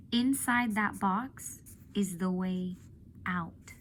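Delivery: slow, weighty, letting each word land; slow emphatic repeat. Real, not acted. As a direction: savor the line for the class. Teacher repeats the deep line.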